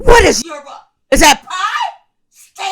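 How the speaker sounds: high shouted questions; shouting over machinery. Acted. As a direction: yell so he can hear. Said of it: "I can't hear you".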